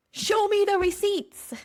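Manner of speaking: high, emphatic mock demand; all-caps shout. Shown as a demonstration. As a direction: belt it out as if in capital letters. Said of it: "say this how I wrote it ... all capital letters".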